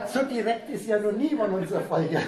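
amused disbelief, laughing it out. Real, not acted. Teacher laughing as he reads the student's rude email aloud.

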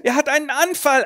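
high, loud alarm; a terrified mother; panicked cry. Acted.

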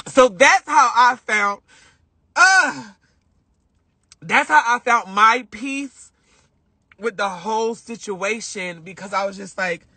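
ordinary talk, nothing marked

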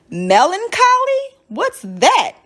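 put-on high, questioning child's voice; puzzled question. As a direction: ask about a strange new word. Child puzzled by unknown word.

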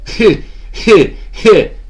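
smug laugh in a player's voice; gloating laugh. Retold or imitated. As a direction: laugh it off like a vindicated ex-quarterback. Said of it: "He's laughing".

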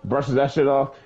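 ordinary talk

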